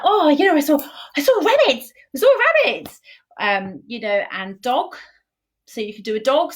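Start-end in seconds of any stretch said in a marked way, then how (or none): 0.0-3.0 high, fast, excited rabbit chatter. Shown as a demonstration. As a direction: squeal it quickly like an excited rabbit. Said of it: "Sometimes you can do your teeth".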